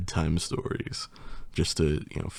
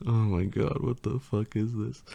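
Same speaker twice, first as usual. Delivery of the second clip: ordinary delivery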